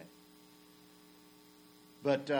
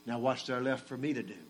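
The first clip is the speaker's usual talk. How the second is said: ordinary talk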